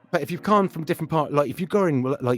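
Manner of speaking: Bristol accent demo; West Country accent. Shown as a demonstration. Showing how accents change going west, Bristol accent. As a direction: slip into a West of England accent. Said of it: "your accent's gonna change like that".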